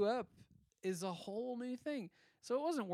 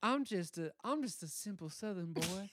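put-on Southern accent; 3 semitones higher than usual. Acting simple Southern boy.

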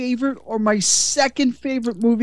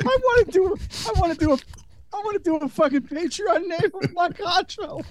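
laughing; 6 semitones higher, 4 dB quieter than usual. Real, not acted. Laughing excited rant.

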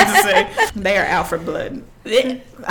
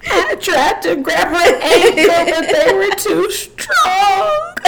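higher, laughing mock-sobbing cry; hammed-up anguish; 8 semitones higher, slower than usual. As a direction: overplay the tragic survivor, voice cracking. Mock dramatic survivor account.

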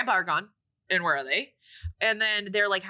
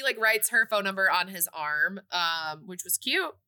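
ordinary talk, nothing marked

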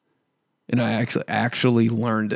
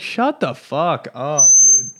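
annoyed retort; 4 dB louder, much slower than usual. Retold or imitated. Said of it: "I was like".

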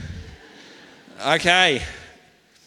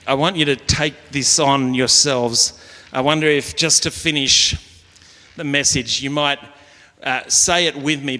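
ordinary talk, nothing marked

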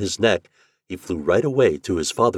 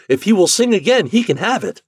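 high, loud, eager assent; raised, enthusiastic storybook voice; eager agreement; 12 semitones higher, 6 dB louder than usual. Acted. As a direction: agree eagerly for the whole crowd. Millers eagerly agree to bargain.

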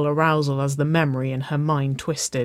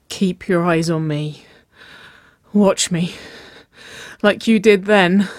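insistent command, building louder; demanding; 4 semitones higher, 5 dB louder than usual. A narrator's reading. Nicole telling her lover to watch her, demanding. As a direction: press each phrase, rising in urgency. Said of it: "she demanded".

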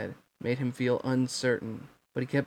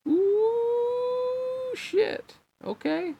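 startled aside; much slower than usual. Real, not acted. Reader stumbles, reacts aside.

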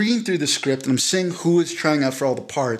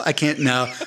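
ordinary delivery

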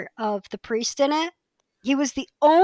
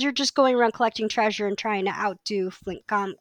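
normal talking voice

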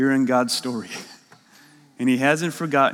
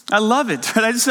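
ordinary talk

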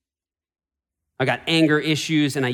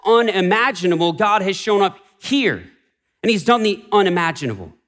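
raised, ringing pulpit voice; full-force preaching; 6 semitones higher than usual. Acted. Pastor proclaiming to the congregation, full-force preaching. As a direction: preach it out to the whole room.

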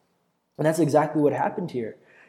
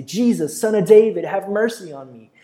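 high, loud plea easing at the end; crying out; 4 semitones higher, 6 dB louder than usual. Acted. Blind beggar calls to Jesus passing by, crying out. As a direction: call out louder, begging for mercy. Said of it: "began to cry out and say".

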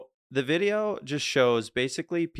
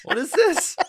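high laughing disbelief; 7 dB louder than usual. Real, not acted. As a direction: laugh through the words. Laughing at the video.

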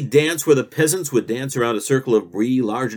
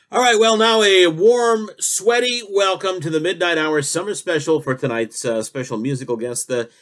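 radio host intro; 3 semitones higher than usual. Acted. Host welcoming listeners to the summer special, announcer voice. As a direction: roll out the welcome like a late-night host. Talk show host opens the show.

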